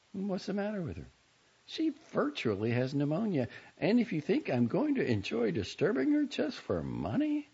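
lightly voiced girl, put-upon and haughty; a hint of an offended rich girl; huffy teenage girl claiming her mother is ill; Selina voice. A narrator's reading.

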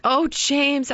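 mother thanking husband, tearful joy; grateful mother. Acted. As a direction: pour out her gratitude to her husband.

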